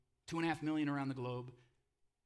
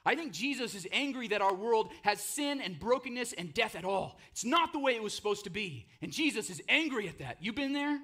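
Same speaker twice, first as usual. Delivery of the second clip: louder, higher, driving sermon voice; fervent preaching; 7 semitones higher, 4 dB louder than usual. Acted. Pastor stirring the congregation about anger at death, fervent. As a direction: preach it hard, building heat.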